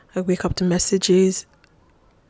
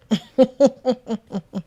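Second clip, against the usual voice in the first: higher-pitched rippling laugh; 5 semitones higher than usual. Real, not acted. Storyteller amused by being left waiting, rippling laugh. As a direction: laugh in a run of short bursts.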